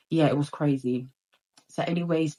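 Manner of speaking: ordinary talk, nothing marked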